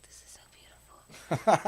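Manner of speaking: slow, breathy whisper with no voice; awed whisper. Retold or imitated. Singing at sunset, whispering how beautiful it is. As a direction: hush it like you're taken by the sunset. Retold moment at sunset show.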